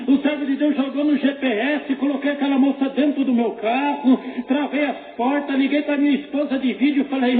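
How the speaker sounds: high-pitched, fervent pulpit storytelling; impassioned testimony preaching. Real, not acted.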